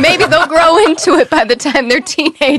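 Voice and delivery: half-laughing mock dismay; amused worry. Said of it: "I was like".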